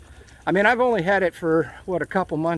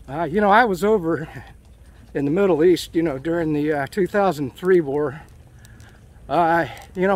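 ordinary delivery

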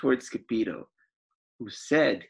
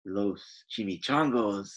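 growled; 4 semitones lower than usual. Acted. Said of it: "growled Don Diego".

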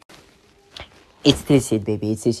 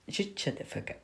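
whispered, soft mock threat; soft whispered insult; whispered shut up; 5 semitones higher, 16 dB quieter than usual. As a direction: hiss it under the breath.

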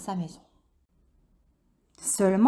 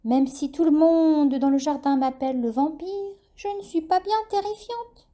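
high, gentle self-deprecation; friendly bat voice; 5 semitones higher than usual. A narrator's reading. Dolly the bat speaking.

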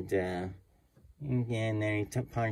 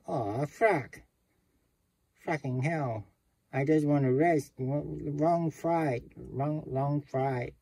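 mildly voiced weary grumble, pitched up; grumbling tourist; 6 semitones higher, slower than usual. Tired guest grumbles to himself, lightly voiced. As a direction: complain to yourself, worn out from travel.

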